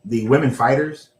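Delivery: ordinary talk, nothing marked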